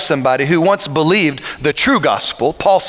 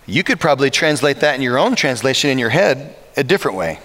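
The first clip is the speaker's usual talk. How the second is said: ordinary talk, nothing marked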